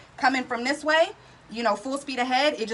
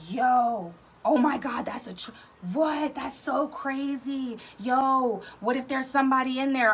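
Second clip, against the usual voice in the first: friend freaking out; 2 semitones higher, slower than usual. Retold or imitated. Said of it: "Megan automatically starts freaking out".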